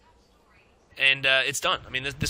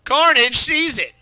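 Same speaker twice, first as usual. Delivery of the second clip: excited; 8 semitones higher, 7 dB louder, slower than usual. Real, not acted. Excited call, slowed.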